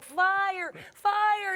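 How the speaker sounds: high-pitched mock alarm call; imitated yelling. Customer alerting her nail tech, imitated yell. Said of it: "I start yelling at my nail tech".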